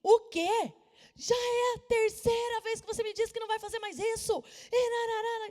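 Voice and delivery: mother scolding her son, shouting; angry outburst. Retold or imitated. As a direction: explode at the kid in disbelief. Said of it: "Eu soltei uma assim".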